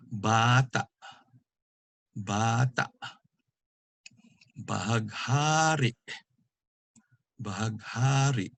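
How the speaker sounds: long BA in BATA, long HA in BAHAGHARI, both cut off at the end; glottal catch. Shown as a demonstration. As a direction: stretch the next-to-last syllable of BATA and BAHAGHARI, then close the throat. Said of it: "a catch in the throat".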